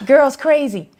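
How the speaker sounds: high, loud mock-incredulous nurse; imitated nurse. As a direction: blurt out the verdict like a scandalized nurse. Imitates the nurse's dismissive verdict.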